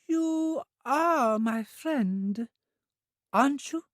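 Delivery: put-on high, very slow childlike voice, worried; an anxious child seeking reassurance; high drawn-out anxious childlike question; anxious Trudy. A narrator's reading. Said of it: "said anxiously".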